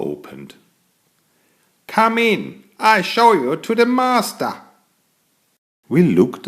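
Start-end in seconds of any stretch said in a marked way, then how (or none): 1.8-4.5 much higher, small dwarf voice; little dwarf voice. Acted. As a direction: squeak it like a tiny doorman dwarf. A hidden dwarf greets them.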